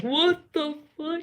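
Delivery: high-pitched mock shock; incredulous. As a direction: squeak it as if reliving the shock. Retelling shock at spirit visitors.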